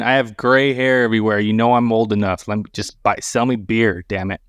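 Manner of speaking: ordinary talk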